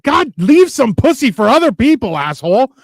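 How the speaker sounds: high, loud mock outrage at a rival; mock outraged yell. To the ripped Australian firefighter, mock outraged yell. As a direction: yell it like a jealous friend. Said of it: "I'm like".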